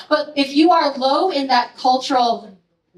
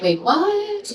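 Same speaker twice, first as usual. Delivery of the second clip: mock surprise; 8 semitones higher than usual. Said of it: "like, chatter about this".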